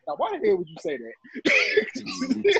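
slow, drawn-out indignation; mild mock indignation; slow indignant question. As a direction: call out the boss slowly. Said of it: "somebody was just like".

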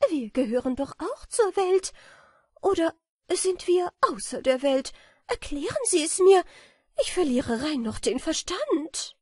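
put-on high, loud, fearful girlish voice; a panicked little butterfly begging for answers; rising alarm, high and pleading; frightened butterfly. A narrator's reading. Frightened butterfly Notburger speaks.

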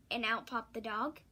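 usual speaking voice

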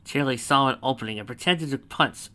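usual speaking voice

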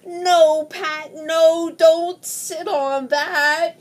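loud, slow no; loud warning. Acted. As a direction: call out no, drawn out. Warning Pat loudly.

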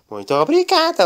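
high squeal of thanks; gushing thanks. Acted. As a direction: squeal your thank-you. Daniela thanks happily.

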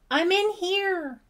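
high, drawn-out scream for help. A narrator's reading. Ellie screaming to rescuers at the door. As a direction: cry out long and loud to be found. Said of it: "Ellie screamed".

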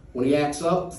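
usual speaking voice

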